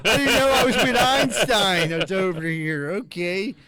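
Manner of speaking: loud, high, drawn-out mockery; mock the supposed genius at full voice; sarcastic awe. Real, not acted. Teasing friend who says he graduated.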